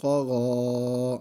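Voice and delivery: قا غا with both vowels held one alif long, a heavy ق and غ; slow, heavy, drawn-out قا غا; stretched long vowels. Shown as a demonstration. Teacher stretches the vowels to show the class the length. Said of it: "وقفاً بھی".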